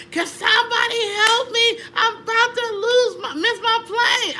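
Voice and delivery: high, loud, desperate cry for help; loud yell. Retold or imitated. Passenger about to miss her plane, high panicked yell. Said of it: "I just yell loud".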